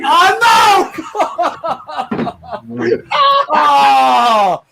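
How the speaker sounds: high, loud pained yelp of laughter; anguished laughing wail. Real, not acted. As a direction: cry out as if wounded, half laughing. Reacting to an embarrassing image.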